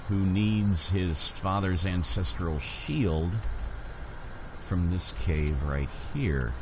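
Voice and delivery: usual speaking voice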